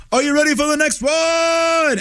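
high, shouted DJ call to the crowd; DJ hype shout. Retold or imitated. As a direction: shout it like a club DJ. Imitating DJ hyping crowd.

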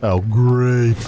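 drawn-out, sarcastic "great". Shown as a demonstration. Host retrying the coached response, really sarcastic. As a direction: stretch "great" out with heavy sarcasm. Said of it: "sarcastic way".

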